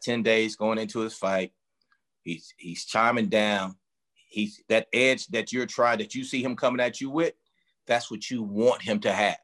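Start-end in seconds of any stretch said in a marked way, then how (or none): none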